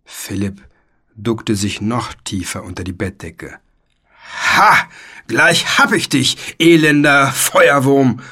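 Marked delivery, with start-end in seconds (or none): 4.6-8.2 loud, slow theatrical battle cry; knight's roar. A narrator's reading. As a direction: bellow it with drawn-out menace. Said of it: "brüllte der weiße Ritter".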